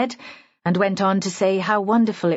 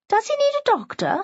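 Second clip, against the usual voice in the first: high, quick alarmed question; much higher, hurried worried voice; concerned onlooker; faster than usual. A narrator's reading. Woman guest fears he is ill, high quick concern.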